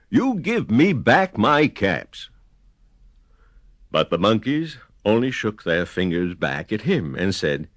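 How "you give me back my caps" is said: peddler scolding. A narrator's reading. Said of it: "shaking a finger at them".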